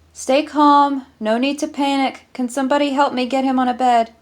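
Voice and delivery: rally help fast over a fallen man; urgent call for help. Acted. Medic kneels by collapsed man.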